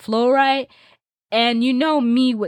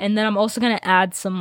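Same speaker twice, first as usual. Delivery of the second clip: usual speaking voice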